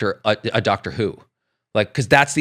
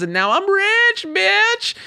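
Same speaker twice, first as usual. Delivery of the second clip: high gloating taunt; much slower than usual. Pretend. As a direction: taunt the troll with glee. Gloating at commenter.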